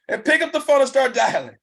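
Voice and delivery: high, loud rallying call; fired-up preaching. Real, not acted. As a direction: whip the crowd up to dial. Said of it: "I sound like".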